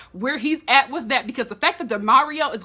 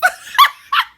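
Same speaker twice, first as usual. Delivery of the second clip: high squealing burst of laughter; shrieking laugh; 9 dB louder than usual. Real, not acted. Laughing at own joke.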